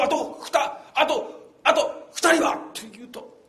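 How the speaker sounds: ordinary talk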